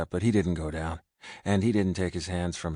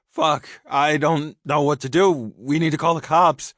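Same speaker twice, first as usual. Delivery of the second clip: high, loud, frantic; panicked; 9 semitones higher, 9 dB louder than usual. A narrator's reading. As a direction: say it in rising panic. Panicked after shooting.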